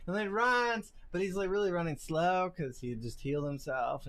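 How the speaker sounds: ordinary talk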